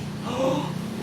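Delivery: high, breathy mock gasp. Friend gasping in mock shock at gossip. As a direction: gasp like you heard juicy gossip.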